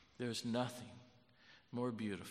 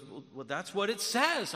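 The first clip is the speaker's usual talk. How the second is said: raised, louder quoted voice; insistent retold reply; 8 semitones higher, 8 dB louder than usual. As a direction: act out an eager comeback. Acted excited exchange with new believer.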